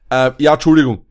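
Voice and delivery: forced loud sorry after a throat catch; loud clearing apology. Real, not acted. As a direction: push through the frog, loudly. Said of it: "ich hab irgendwas im Hals".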